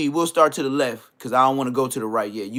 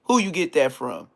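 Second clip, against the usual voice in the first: ordinary talk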